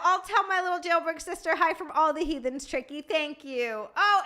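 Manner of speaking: ordinary delivery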